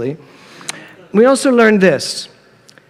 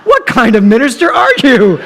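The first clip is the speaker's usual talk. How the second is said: exasperated outburst; 6 semitones higher, 4 dB louder than usual. Retold or imitated. Mother fed up with her minister husband, loud and exasperated. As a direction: cry out at him, fed up and upset. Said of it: "And I was like".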